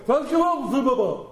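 garbled starstruck babble, starting high; mock tongue-tied. Admirer grabbing his hero's hand, mock tongue-tied babble. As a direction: collapse into hammed-up gibberish. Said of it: "and I would go".

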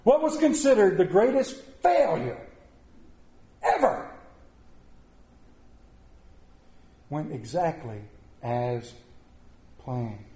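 preacher landing the point: ever soaring, then as planned hushed; dramatic pause. Real, not acted. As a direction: build up then let it fall to a hush. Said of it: "dramatic build then drop".

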